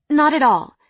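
eager. A narrator's reading. Fiancee insists on the meeting.

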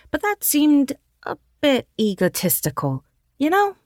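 sheepish little sister. Acted. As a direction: confess it with a cheeky grin. Riley explains sheepishly.

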